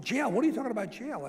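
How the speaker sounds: startled, high, loud alarm; shocked disbelief. Retold or imitated. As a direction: react like he just heard the word jail aimed at him. Bernie shocked by jail question.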